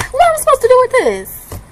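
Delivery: high, incredulous exasperation at the empty roll; exasperated. Real, not acted. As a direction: throw the question up in disbelief.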